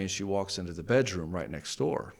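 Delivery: ordinary talk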